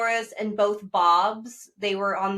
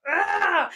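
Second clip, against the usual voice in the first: mock gasp of shock; 8 semitones higher than usual.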